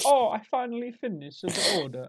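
high, loud comic Italian worker; Italian accent. Acted. Lazy Italian worker putting off an order, mock Italian accent. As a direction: play a hammed-up Italian slacker. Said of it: "I say Italian".

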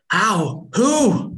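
high, loud, sharp demanding cry; furious demand. Acted. Mother demanding who killed her son, furious. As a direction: spit out the questions in rising rage. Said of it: "I demand".

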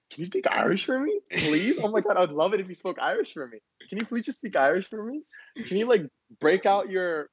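high, eager mock pleading; gushing tourist. Imagined outsider begging an Irish person to perform, mock gushing. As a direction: gush in a high, fawning put-on voice.

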